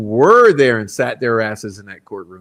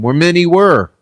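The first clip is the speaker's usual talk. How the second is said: sarcastic retort; 6 semitones higher, 6 dB louder than usual. Real, not acted. Scoffing at juror's quote.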